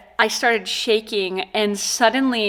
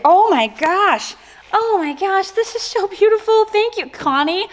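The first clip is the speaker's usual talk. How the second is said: delighted gush; 10 semitones higher than usual. Real, not acted. Opening scrapbook gift.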